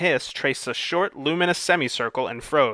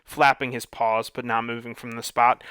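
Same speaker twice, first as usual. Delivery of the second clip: plain reading voice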